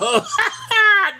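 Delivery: real high cackle; high laugh. Real, not acted. Streamer laughing again at the chat's joke with a high laugh.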